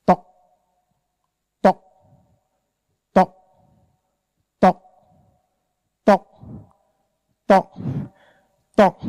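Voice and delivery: isolated short tocks, evenly spaced; leaking-roof drip. Acted. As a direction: imitate water dripping, one tock at a time. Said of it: "like the roof that is leaking".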